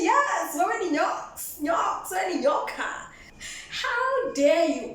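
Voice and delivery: high, laughing indignation; mock outrage. Woman calling the guy a snake, half-laughing outrage. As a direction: scold him while laughing. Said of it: "I'm like".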